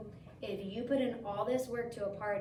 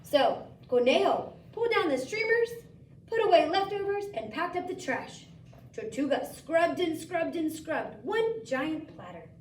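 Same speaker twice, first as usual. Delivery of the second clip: lively story narration; 5 semitones higher, 7 dB louder than usual. A narrator's reading.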